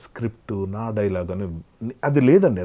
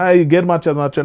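louder mock backseat order; bossy backseat driver; 4 semitones higher, 7 dB louder than usual. Imitating a co-passenger ordering the driver, louder. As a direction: bark the gear order like a meddling passenger.

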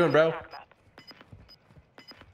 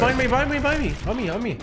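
urgent shout; 12 semitones higher, faster than usual. Real, not acted. Enemy attacks from behind.

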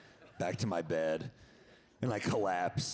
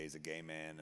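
ordinary delivery

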